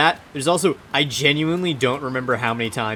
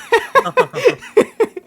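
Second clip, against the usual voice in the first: loud, high laughing fit; burst of laughter; 10 dB louder than usual. Real, not acted. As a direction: crack up loudly, pitch shooting up.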